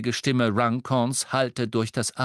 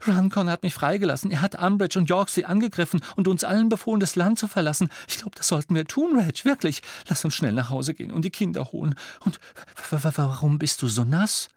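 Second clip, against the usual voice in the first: high, breathless rush of news; frantic babbling; 9 semitones higher, 3 dB louder than usual. Acted. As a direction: gush urgently to your husband. Mrs Cattermole babbles in panic.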